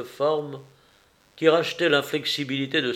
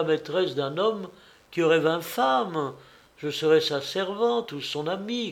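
higher daughter's voice, no shouting; earnest, resolute daughter; 4 semitones higher than usual. Acted. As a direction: plead your case to a father, pitched up. Narrator voices the pleading daughter.

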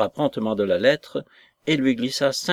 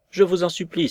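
higher-pitched plea; pleading cry; 6 semitones higher than usual. A narrator's reading. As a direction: beg in a higher voice, without shouting. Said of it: "s'écria-t-elle".

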